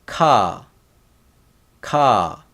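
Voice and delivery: ka, with a puff after the K; K sound demo. Shown as a demonstration. Teacher showing the K sound, demonstrating "ka" distinctly. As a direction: say "ka" as a separate model syllable, falling. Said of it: "K sound".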